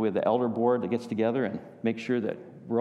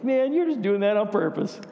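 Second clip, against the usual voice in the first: put-on higher, louder whiny protest from the kid; playful kid voice, pitched up and louder; mock kid protest; 10 semitones higher, 4 dB louder than usual. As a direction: complain like a caught-out child. Kids protesting father's extra whack.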